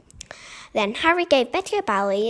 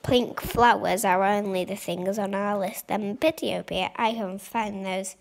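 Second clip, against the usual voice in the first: ordinary delivery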